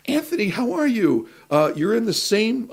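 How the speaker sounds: high, chirpy friendly greeting; bright PA greeting. Retold or imitated. PA Debbie greeting him on the lot, high and chirpy. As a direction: greet him like a cheerful assistant.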